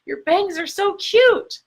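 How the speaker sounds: high, bubbly gush over the bangs; gushing compliment. Retold or imitated. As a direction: squeal it like an excited friend. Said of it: "And when they say".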